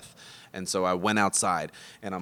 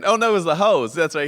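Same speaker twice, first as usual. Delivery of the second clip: ordinary delivery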